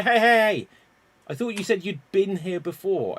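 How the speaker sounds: high, loud hey hey hey of a bar quarrel; loud mock call-out. Garbage man hollering across the bar, loud and high. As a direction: shout them down in a bar.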